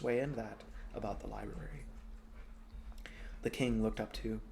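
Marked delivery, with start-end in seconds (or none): none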